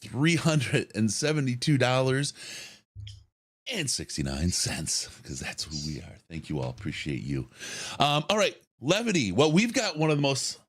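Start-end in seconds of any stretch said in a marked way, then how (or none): none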